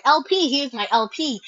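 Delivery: usual speaking voice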